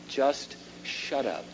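ordinary talk

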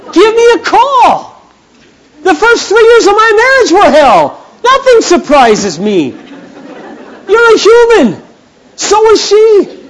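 high, loud, slow and earnest; emphatic reassurance. Retold or imitated. Pastor comforting a struggling young couple, emphatic. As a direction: reassure them there's no shame.

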